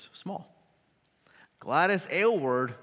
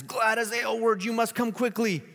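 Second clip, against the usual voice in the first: higher, louder urgent call; urgent messenger; 11 semitones higher, 5 dB louder than usual. Acted. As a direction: summon her in a hurry. Messenger summons Gladys urgently.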